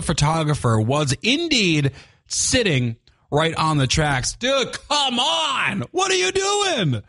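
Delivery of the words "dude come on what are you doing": high, slow, exasperated yell; exasperated outburst. Real, not acted. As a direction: scold them in disbelief. Scolding trespassing photographers.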